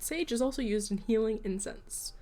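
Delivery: ordinary talk, nothing marked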